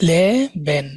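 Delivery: লে বেন in two separate beats, short pause between; syllable by syllable. Shown as a demonstration. Teacher giving a two-syllable example, word split apart. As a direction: pause after লে before saying বেন. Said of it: "এরকম দুই syllable".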